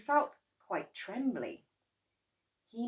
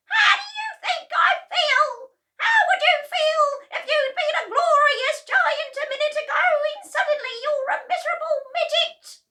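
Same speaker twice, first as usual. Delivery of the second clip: very high, loud yell of a tiny, furious grandma; 11 dB louder than usual. Acted. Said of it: "she yelled".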